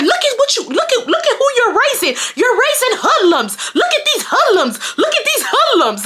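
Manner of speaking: very high, scandalized mock holler; retold yelling. Mom berating the lady over her kids, high mock yell. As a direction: cry out high, drawing out the rebuke. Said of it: "We yelling at sixth graders".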